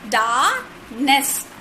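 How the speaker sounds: "Darkness" split as dar, ness, with a gap; syllable by syllable. Shown as a demonstration. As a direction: sound out both beats of "Darkness". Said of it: "darkness have got two syllable".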